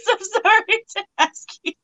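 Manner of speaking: giggling through the words; laughing apology. Real, not acted. Host laughing through an apology to her friend.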